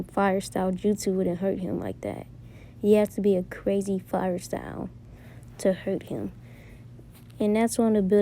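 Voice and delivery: usual speaking voice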